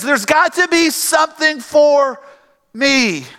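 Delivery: high, drawn-out imitated plea; pleading. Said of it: "He's pleading with his father".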